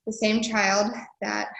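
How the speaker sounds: ordinary talk, nothing marked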